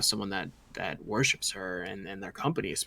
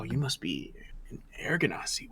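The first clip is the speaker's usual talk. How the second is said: whispered in wonder, softer; awed whisper; 2 semitones higher, 7 dB quieter than usual. Acted. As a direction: breathe it out in amazement. King amazed by the stranger.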